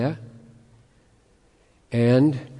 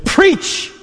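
emphatic; 6 dB louder than usual. Real, not acted. Preacher's emphatic charge.

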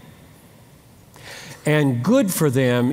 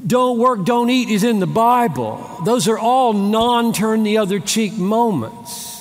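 preaching emphasis; 5 semitones higher than usual. Acted.

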